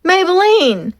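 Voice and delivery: high, loud call into the storm. Acted. Leroy calling for his lost horse, shouting. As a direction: yell her name into the wind.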